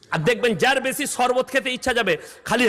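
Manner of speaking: usual speaking voice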